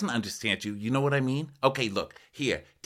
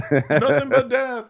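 slow, much higher and louder mock doom; dramatic doom voice; 7 dB louder, slower than usual. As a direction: ham up a dire prophecy, stretched out.

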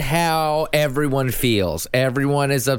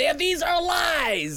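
slow, high mocking take; 13 semitones higher, much slower than usual. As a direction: drawl it out mockingly. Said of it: "just say".

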